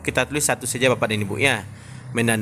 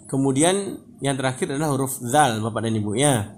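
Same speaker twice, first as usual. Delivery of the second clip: ordinary delivery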